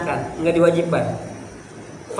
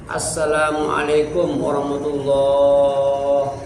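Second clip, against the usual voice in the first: lightly voiced weary imam, slow; tired imam; 2 semitones lower, slower than usual. Teacher mimics an exhausted imam after community work, slow sung salam. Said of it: "capek banget".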